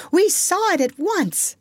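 very high-pitched eager voice; excited cry. A narrator's reading. Boys telling Wendy they knew it, excited cry. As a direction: pipe up high, all agreement. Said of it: "they all cried".

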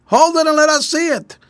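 high, sustained shout to another boat; calling across. A narrator's reading. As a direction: holler the request over the water.

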